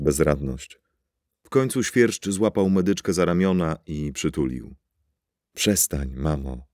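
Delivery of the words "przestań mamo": softer hushed plea; whispered. A narrator's reading. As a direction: whisper it to calm his mother. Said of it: "szepnął".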